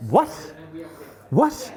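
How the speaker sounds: outraged squeak of disbelief; shocked disbelief. Retold or imitated. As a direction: blurt it out like you misheard. Recruiter stunned by refusal.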